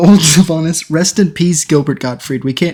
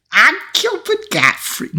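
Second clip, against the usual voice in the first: raised-pitch, slowed, badly attempted Gilbert Gottfried voice; a hammed-up, failed celebrity squawk; Gilbert Gottfried impression; 14 semitones higher, slower than usual. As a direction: squawk it high and slow, failing on purpose. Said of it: "I wanna hear your Gilbert Gottfried voice".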